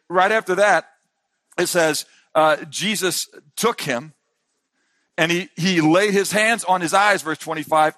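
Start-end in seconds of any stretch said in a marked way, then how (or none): none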